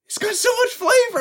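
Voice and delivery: amazed. Retold or imitated. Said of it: "I was like".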